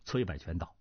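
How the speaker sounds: plain reading voice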